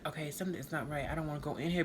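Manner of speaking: soft, quick inner warning; said quickly under the breath to herself. Retold or imitated. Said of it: "'cause I was like".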